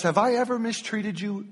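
a wounded, put-upon animal; hurt donkey. Acted. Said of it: "And the donkey says".

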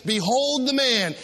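slow, grand announcement; Pilate presenting. Acted. Pilate showing Jesus to the crowd, slow and grand. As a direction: present him to the crowd slowly. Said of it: "he brings him up and he says".

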